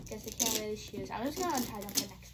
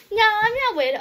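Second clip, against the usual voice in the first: high, loud denial; hammed-up outraged denial; mock indignant protest; 10 semitones higher, 12 dB louder than usual. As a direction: protest loudly at a raised pitch. Playful protest, acted again.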